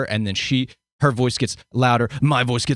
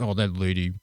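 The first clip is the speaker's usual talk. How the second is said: mock eye-rolling dismissal; 2 semitones lower than usual. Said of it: "roll their eyes like".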